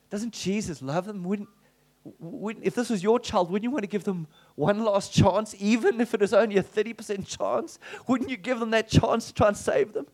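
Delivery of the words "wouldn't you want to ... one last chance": normal talking voice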